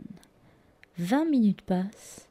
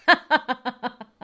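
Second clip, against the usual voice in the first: laugh; 4 semitones higher, 4 dB louder than usual. Retold or imitated. Nurse laughs.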